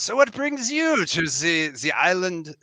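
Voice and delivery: put-on British accent sliding into French, high start; lively, high French-lilted accent; accidental French lilt. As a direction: say "the island" as "ze island", French-tinged accent. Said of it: "where's that Z coming from".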